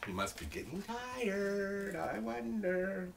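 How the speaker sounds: high, stretched-out musing; drawn-out wondering. Real, not acted. Teacher wonders about the dragon, slow and musing.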